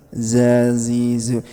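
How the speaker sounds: "Zazizu" drawn out on a level tone; slow pronunciation demo of "Zazizu"; letter drill. Shown as a demonstration. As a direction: chant "Zazizu" slowly with long vowels and buzzing z. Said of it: "bahwasanya zai itu enggak boleh desis".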